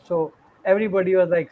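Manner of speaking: usual speaking voice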